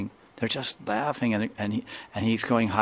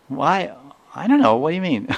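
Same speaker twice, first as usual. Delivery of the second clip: put-on high child voice, baffled by the question; puzzled child; 8 semitones higher, 6 dB louder than usual. The swinging child, puzzled, answers the monk in a high voice. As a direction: squeak it out like a confused kid.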